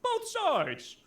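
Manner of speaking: mock a wishy-washy pundit; mocking liberal voice. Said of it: "liberal outlets who are like".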